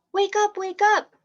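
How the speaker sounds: high, loud cartoon animal voice; bright storybook animal calls. A narrator's reading. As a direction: bark it out like an eager farm dog. Rooster and dog voiced in story.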